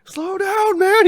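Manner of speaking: very high, loud yell; frantic high-pitched passenger outcry; panicked yell. Retold or imitated. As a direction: yell at a speeding driver in alarm. Yells as car hits ninety.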